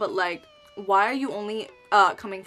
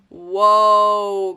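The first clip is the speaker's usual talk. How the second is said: taken-aback whoa; 11 dB louder than usual. Retold or imitated. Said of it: "I was like".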